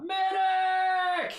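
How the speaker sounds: loud desperate call, very high; shouting for a medic. Acted. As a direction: yell it across the room. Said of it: "make it in the front door and call out".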